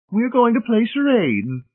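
very high, joyous matron; high, gleeful announcement. A narrator's reading. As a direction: announce it gleefully, voice pitched right up. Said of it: "informed him joyously".

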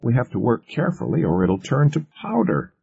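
protesting. A narrator's reading. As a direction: object anxiously to the order, pitch up. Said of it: "one of them protested".

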